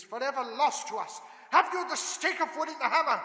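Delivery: loud, high pressing demand; urgent shout. Acted. Van Helsing shouting at Seward for the stake. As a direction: demand the tools, no time left.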